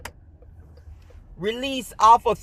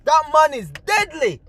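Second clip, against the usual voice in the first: higher, awestruck imitation of amazed kids; awed kids; 6 semitones higher than usual. Kids watching through the window marvel at the character, higher and awestruck. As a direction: gasp it out like you just saw a monster move. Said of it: "everybody's like".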